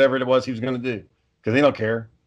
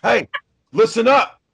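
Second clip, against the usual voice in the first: short, higher commands in a dad voice; dad yelling; 9 semitones higher, slower than usual. Shown as a demonstration. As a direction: bark orders like a stern dad. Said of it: "the dad voice, the dad yelling".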